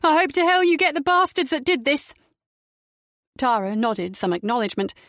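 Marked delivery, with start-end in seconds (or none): 0.0-2.1 high, loud angry mother voice; bitter mother. A narrator's reading. Mother demanding the police catch her son's attackers. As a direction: spit it out at the bastards.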